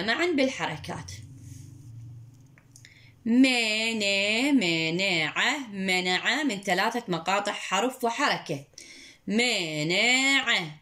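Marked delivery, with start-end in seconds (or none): 3.2-5.6 slow model word. Shown as a demonstration. Teacher to pupil, modelling مانع very slowly. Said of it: "teacher models word slowly". 9.3-10.7 slow model word. Shown as a demonstration. Teacher to pupil, modelling مانع very slowly. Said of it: "teacher models word slowly".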